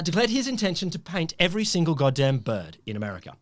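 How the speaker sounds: usual speaking voice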